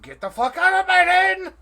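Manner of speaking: high, loud enraged scream; furious screech. Acted. Mary screaming Gortek out of her inn. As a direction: throw him out at the top of her voice. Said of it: "And she's like".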